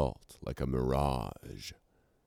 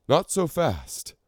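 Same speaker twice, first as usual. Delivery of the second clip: much higher call to halt them; cried out; 4 dB louder than usual. A narrator's reading. The scribe king halts the Icons, calling out high. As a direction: cry out to stop everyone. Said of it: "he cried".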